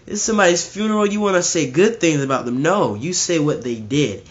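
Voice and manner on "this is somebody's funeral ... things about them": higher, louder mock protest; objecting mourner. Imagined churchgoer objecting to the preacher, higher mock protest. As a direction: whine it like an offended mourner, higher. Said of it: "And you may say".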